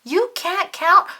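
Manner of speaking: astonished shout. A narrator's reading. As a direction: blurt it out in disbelief. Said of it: "shouted Ernie".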